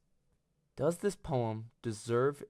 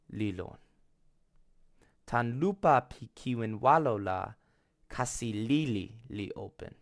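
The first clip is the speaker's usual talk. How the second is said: poem recitation; 3 dB louder than usual. Acted. Narrator recites a Toki Pona poem before analysing it, measured recitation. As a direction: recite it line by line, deliberately, letting each phrase stand.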